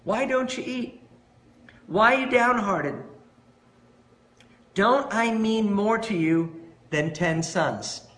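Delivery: lightly voiced concerned husband; pleading husband. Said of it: "and say".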